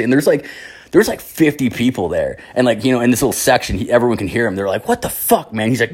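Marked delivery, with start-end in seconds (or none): 4.9-5.7 high-pitched mock outrage; incredulous, higher-pitched group reaction; retold shock. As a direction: echo the appalled relatives in a high voice. Relatives react, shocked, to the uncle.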